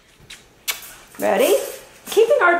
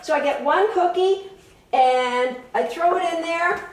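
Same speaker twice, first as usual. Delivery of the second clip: ordinary talk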